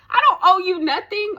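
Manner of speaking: high, loud indignant burst; ranting. Real, not acted. Vlogger ranting at the photographer who wants money, indignant. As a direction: fire it off at the peak of a rant. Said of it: "I'm going on a rant".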